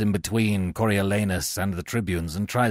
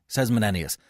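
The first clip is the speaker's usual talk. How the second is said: ordinary delivery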